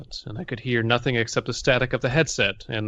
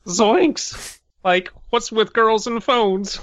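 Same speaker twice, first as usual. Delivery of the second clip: high, slow stoner drawl; Shaggy voice; 10 semitones higher, 4 dB louder, much slower than usual. Acted. Shaggy impression.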